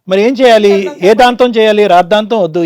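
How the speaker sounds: loud, forceful questioning; heated retort. Real, not acted. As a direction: fire his word back at him loudly. Host retorts to husband in quarrel. (గట్టిగా ఎదురు ప్రశ్నిస్తూ)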